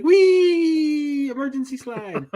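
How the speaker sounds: high, long drawn-out wheee; acted joyride squeal tailing off; gleeful slide cry. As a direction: whoop like you're sliding down. Acting out riding down the slide.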